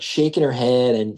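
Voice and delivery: normal talking voice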